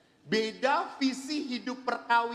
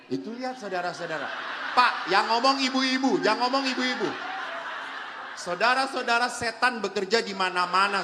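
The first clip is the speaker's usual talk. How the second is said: loud preacher cadence, laughing; fiery preaching; 5 dB louder than usual. Acted. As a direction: preach it at full force. Parodi khotbah berapi-api. (berkhotbah berapi-api dengan suara keras)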